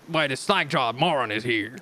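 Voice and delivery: eager, menacing warrior; menacing Huntress voice. Acted. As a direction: ask it hungry for a kill. Huntress threatens.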